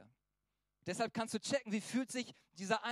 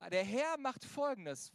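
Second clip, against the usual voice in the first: ordinary talk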